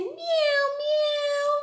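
very high little mews; kitten meowing. Pretend. Teacher showing children a playing kitten, high meows. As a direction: mew like a playful kitten. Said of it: "an orange kitten".

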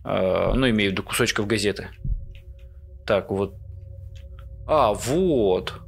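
ordinary delivery

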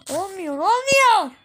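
slow, high, loud lament; despairing wail. Real, not acted. Gamer dying yet again, slow loud lament. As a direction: drag out the despair.

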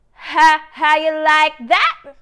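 mock K-pop swagger; challenging attitude. Shown as a demonstration. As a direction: throw it out like a dare. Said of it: "magkakaroon ng attitude sa".

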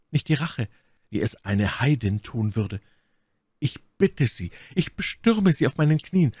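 My Lady pleading from her knees, voiced high; a captive woman begging for death, lightly voiced; gentle pleading. A narrator's reading. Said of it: "Bei dieser sanften, bittfälligen Stimme". (My Lady fleht Felton kniend an, hoch und bittend)

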